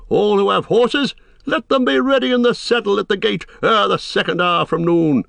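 high, ringing royal orders; rally the army as their king; commanding. A narrator's reading. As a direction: proclaim it high, urgent for war. King giving orders to muster.

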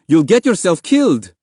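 high, loud warning shout; urgent call. A narrator's reading. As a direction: warn your child in alarm. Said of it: "his father called".

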